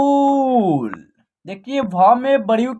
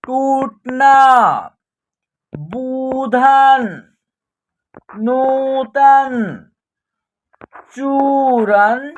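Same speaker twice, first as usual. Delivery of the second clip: slow, stretched syllables on a level pitch; drawn-out word drill; 4 dB louder, much slower than usual. Shown as a demonstration. Teacher reading out a Hindi word list for practice, drawn out. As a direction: read each word slowly, holding the long vowels.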